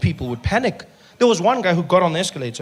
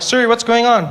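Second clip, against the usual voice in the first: alarmed driver; 5 semitones higher, 6 dB louder than usual. Retold or imitated. Driver alarmed by silent Siri.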